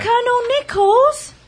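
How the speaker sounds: eager call. Acted. Girl calls him again.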